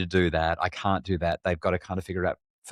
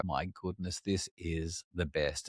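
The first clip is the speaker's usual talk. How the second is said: much softer, slow and tender; hushed delight; 10 dB quieter, slower than usual. Retold or imitated. Grandparents watching their granddaughter, soft tender awe. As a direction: savour it under your breath. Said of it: "we kinda go".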